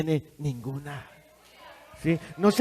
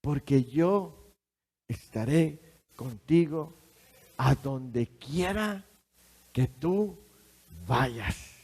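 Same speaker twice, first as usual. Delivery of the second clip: soft, slow and lower voice; softer slow reading of the promise; slow tender promise; 3 semitones lower, 5 dB quieter, slower than usual. Acted. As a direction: let each word of the promise land.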